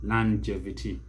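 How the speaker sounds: longevity as lan-JEV-ity; American pronunciation. Shown as a demonstration. Teacher rounds off the American version, American pronunciation. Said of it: "For the Americans".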